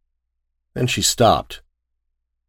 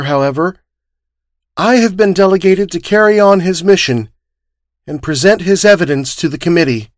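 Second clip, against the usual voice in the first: much higher, strained formal announcement; formal statement; 9 semitones higher than usual. Acted. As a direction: hold composure while grieving, address the committee. Girl forces formal statement.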